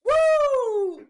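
high, loud, whooping cheer; excited whoop. Acted. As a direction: yell it out like a cheer. Joking cheer after fake spoiler.

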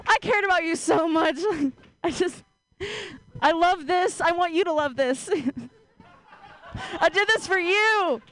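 gently mocking the proud house cat; cat's proud voice. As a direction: play the cat presenting its gift, lightly. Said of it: "when a cat brings you a dead animal".

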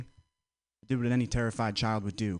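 ordinary delivery

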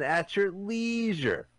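"leisure" drawn out as LEE-zhur, pitched high; long-E leisure. Real, not acted. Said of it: "leisure, not leisure".

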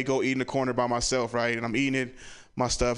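ordinary talk

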